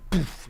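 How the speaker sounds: quick soft puff after the boom; airy vocal sound effect; imitated hit noise. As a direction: puff out a quiet impact sound.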